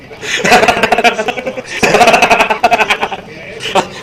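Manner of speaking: long, high cackling laugh; helpless real laughter; real belly laugh. Real, not acted. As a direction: crack up at your own story. Cracking up at own story.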